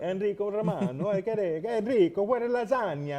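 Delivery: mock Italian accent, playful. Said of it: "I try to do my Italian accent".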